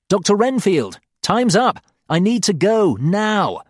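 loud, high urgent shouting. Acted. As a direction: shout through the door. Shouting through locked door.

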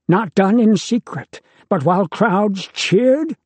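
a father hammering his point at his son. A narrator's reading. Deucalion passionately lectures his son.

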